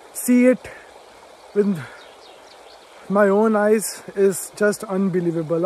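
real awe, breaking off mid-sentence as feeling takes over; overwhelmed with emotion. Real, not acted. Fan seeing the Harry Potter bridge at last, overwhelmed. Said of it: "I'm just too emotional right now".

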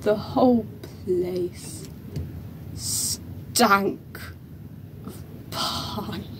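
slow, soft, nearly voiceless; hushed recollection. Acted. Recalling the dressing room that stank, hushed recollection. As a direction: let it sink slowly towards a whisper.

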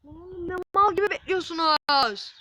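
loud, high scolding shout; exasperated yell. Real, not acted. Gamer scolding players for just standing there, exasperated yell. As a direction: berate the idle players at volume.

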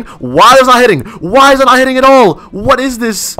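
high, loud exasperation; heated, high-pitched complaint; frustrated yelling. Real, not acted. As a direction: shout in disbelief at the broken game. Hits fail to land.

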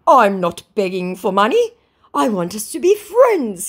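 high, loud mock plea; hammed-up wounded protest; protesting scammer.

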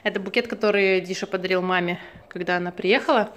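ordinary talk

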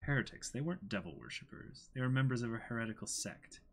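soft, quick narration of the text; reading aloud. Acted. Reading the manga caption to co-host, soft quick read. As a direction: read the caption quietly and briskly.

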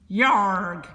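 loud, rough pirate yarg; pirate grunt. Acted. Pirate grunting at the elf, loud pirate voice. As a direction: bark it like a surly pirate.

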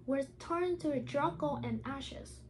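put-on bright, steady read-aloud voice for children; reading a story aloud. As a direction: read the picture book to the class, a little lifted. Teacher reads picture book aloud.

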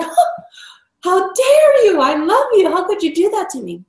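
high, loud, hammed-up wounded outrage at your own dog; mock hurt dog owner. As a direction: play the betrayed owner, squeaky and theatrical. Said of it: "you're like".

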